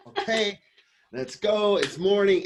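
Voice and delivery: bright, loud and high, bursting with energy; imitating my overeager morning self; chipper morning voice. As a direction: bounce out of bed cheering. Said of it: "'cause I wake up like this".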